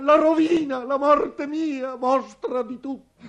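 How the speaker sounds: very high, anguished outburst; desperate cry. Acted. Father announcing death and ruin to his family, desperate cry. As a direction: wail the doom out, drawing out the words.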